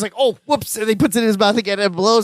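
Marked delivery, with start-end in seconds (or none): 0.2-0.8 high, sheepish oops; lightly acted realisation of a blunder; mild dismay. Retelling Larry learning of his mistake, mild dismay.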